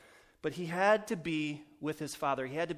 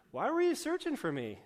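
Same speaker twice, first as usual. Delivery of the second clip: higher, pointed smart-alecky question; 11 semitones higher than usual. Retold or imitated. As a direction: toss the question back cheekily. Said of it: "sounds very, very smart-alecky".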